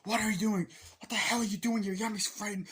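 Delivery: hammed-up shocked wife; mock outraged wife. Wife confronts husband.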